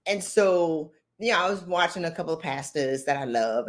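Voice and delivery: normal talking voice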